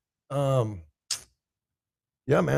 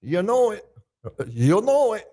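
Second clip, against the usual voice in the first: loud, higher-pitched buddy catchphrase; imitating a buddy; 4 semitones higher, 6 dB louder than usual. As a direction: punch it out in your pal's voice. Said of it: "I read that in your voice too, bro".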